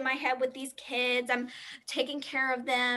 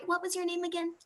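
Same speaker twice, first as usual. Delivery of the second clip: ordinary talk, nothing marked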